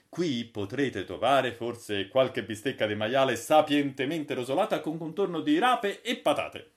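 proudly showing off the future; lightly voiced Holker. Holker explains the automatic bar.

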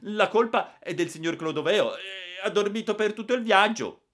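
put-on high, loud, happy boy; pleased piping accusation; gleeful tattling. A narrator's reading. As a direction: pin the blame on him with delight. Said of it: "tutto contento".

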